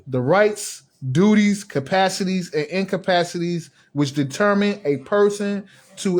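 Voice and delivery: slow, spaced-out reading with pauses between terms. Shown as a demonstration. Reading a legal definition out for first-time listeners, slowly. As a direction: read each term separately and slowly. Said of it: "say it slow for the ones that".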